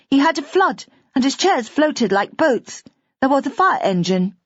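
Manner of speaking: put-on a small girl reliving the flood; excited little girl. A narrator's reading. Little girl telling the grown-ups about Daddy's flood.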